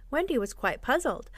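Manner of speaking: usual speaking voice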